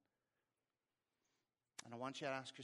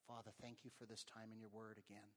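soft, subdued prayer with long pauses; 2 semitones lower, 5 dB quieter than usual. Real, not acted. Preacher praying for congregation to live free, hushed prayer. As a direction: pray quietly, letting each phrase settle.

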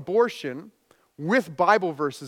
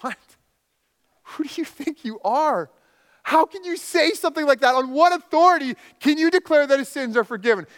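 scoffing; 10 semitones higher, 5 dB louder than usual. Retold or imitated. Pharisees scoffing at Jesus.

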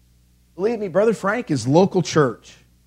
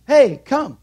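friendly call; 6 semitones higher, 6 dB louder than usual. Acted. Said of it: "So the Spirit says".

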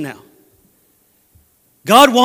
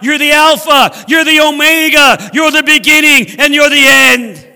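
raised, louder exultant praise; crying out; 4 semitones higher, 5 dB louder than usual. Acted. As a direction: cry out each title in praise, stretching the last. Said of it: "I believe he's crying out".

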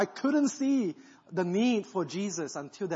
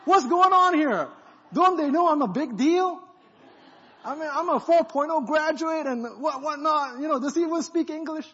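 much higher, loud offended outburst; indignant outrage; 11 semitones higher, 9 dB louder than usual. Retold or imitated. Proud young believer paired with a stranger, loud indignant outburst. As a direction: yell it like an insulted big shot. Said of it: "I'm like".

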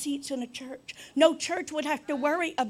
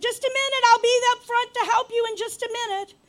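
high, loud call from the back room; busy shop clerk calling out; 11 semitones higher, 9 dB louder than usual. Acted. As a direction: holler to a customer up front.

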